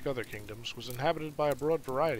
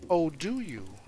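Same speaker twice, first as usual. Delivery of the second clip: slight edge; 4 semitones higher, 4 dB louder, slower than usual. Acted. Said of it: "Her voice had a slight edge to it".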